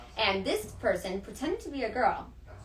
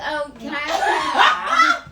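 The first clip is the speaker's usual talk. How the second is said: laughing mock request, breaking into a shout; mock customer begging money back, laughing; mock diner asking refund; 2 semitones higher, 11 dB louder, much slower than usual. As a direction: ham up a cheeky refund ask, then laugh it off loud. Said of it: "and be like".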